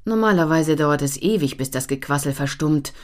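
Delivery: usual speaking voice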